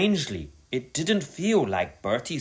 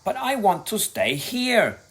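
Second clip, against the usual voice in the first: moaning; 9 semitones higher than usual. A narrator's reading. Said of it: "he moaned".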